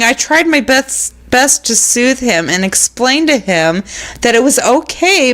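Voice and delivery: ordinary talk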